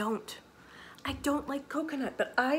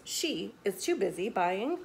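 ordinary delivery

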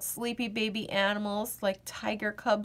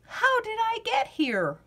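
put-on puzzled child's wonder; 11 semitones higher than usual.

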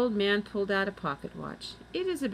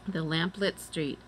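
ordinary delivery, nothing marked

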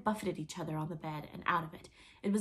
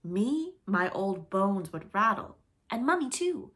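mock scandalized; 2 semitones higher, 7 dB louder, slower than usual. A narrator's reading. Peter to the children, faking shock at dancing. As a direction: pretend to be shocked at the idea of dancing. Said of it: "he pretended to be scandalized".

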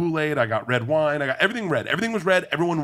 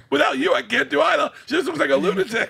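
high, loud, laughing plea; cracking up while justifying yourself; laughing explanation; 5 dB louder than usual. Real, not acted. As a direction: talk through real laughter, pitch way up. Joking and laughing at own explanation.

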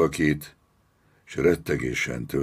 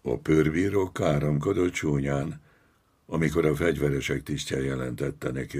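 usual speaking voice

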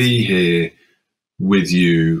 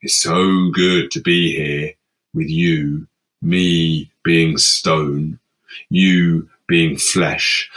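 stone voice; 6 semitones lower, much slower than usual. Acted. Speaking as stone, low and slow.

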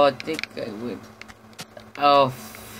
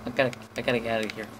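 rushed; 3 semitones lower, 4 dB quieter, much faster than usual. Real, not acted. Fleeing in panic.

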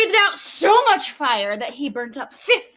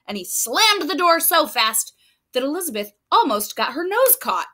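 ordinary delivery

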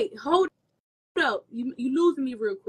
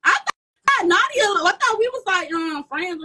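excited outburst; 9 semitones higher, 9 dB louder, slower than usual. Real, not acted. Laughing burst after being asked.

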